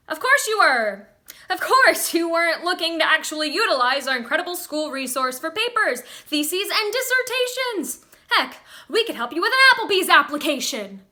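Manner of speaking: loud, high-pitched indignant rant; furious outburst, rushing and climbing in pitch; offended anger. Acted. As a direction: erupt in wounded sarcasm. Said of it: "[name] gets offended. Angry laughing".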